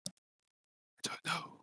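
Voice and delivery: very soft, voiceless whisper; whisper back. Acted. Zhang answering Shiro at the door, whispering. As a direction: breathe the answer back, barely audible. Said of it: "Zhang whispers back".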